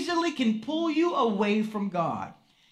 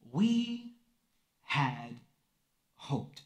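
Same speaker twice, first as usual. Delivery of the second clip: each word apart, lower and sinking to almost nothing; heavy disappointment; 9 semitones lower, 7 dB quieter, much slower than usual. Shown as a demonstration. Pastor dwelling on the disappointed disciples' words, slow sinking sorrow. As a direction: let the hope drain out word by word. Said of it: "three words that capture disappointment".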